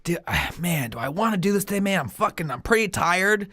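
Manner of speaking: soft, half-whispered reluctant self-talk; acted weariness. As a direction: sigh it out as if worn out. Acts out his pre-match tiredness.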